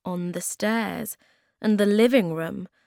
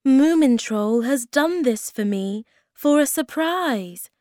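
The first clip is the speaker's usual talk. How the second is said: higher, louder, pleased exclamation; delighted; 7 semitones higher, 7 dB louder than usual. A narrator's reading. Moomin Mama thinks the flowers are a gift, delighted. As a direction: marvel aloud at a lovely surprise.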